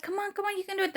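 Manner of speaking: high, soft urging; encouraging doctor. Retold or imitated. Doctor urging the last push, imitated encouragement. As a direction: coax in a high, small voice. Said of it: "the doctor was like".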